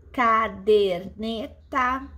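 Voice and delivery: "caderneta" slowly sounded out in separate syllables. Shown as a demonstration. Teacher giving class a polysyllable example, syllable by syllable. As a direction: draw out each syllable of "caderneta".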